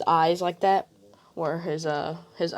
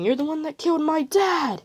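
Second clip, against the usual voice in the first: mock crying scream; 10 semitones higher than usual. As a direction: cry out the blame at him, pitched up. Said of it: "Screaming and crying, saying".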